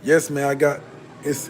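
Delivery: usual speaking voice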